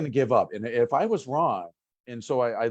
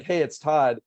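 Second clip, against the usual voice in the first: mock cheery greeting; 6 semitones higher than usual. Mock-greeting the board again.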